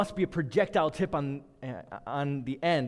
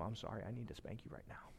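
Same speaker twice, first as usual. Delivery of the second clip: tender apology; 4 semitones lower, 19 dB quieter than usual. Retold or imitated. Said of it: "I say to them".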